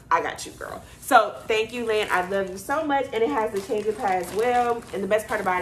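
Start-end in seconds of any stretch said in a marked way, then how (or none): 0.1-0.8 whispered, lower friendly line; whispered reassurance. Retold or imitated. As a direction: hush it like a secret. Friend insisting on paying.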